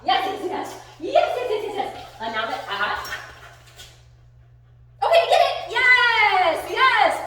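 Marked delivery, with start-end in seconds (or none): 0.0-2.1 high, giddy burst of praise; excited praise. Real, not acted. Rewarding dog with play. 5.0-7.3 high, loud whoop; excited release cue. Real, not acted. Releasing dog to toy.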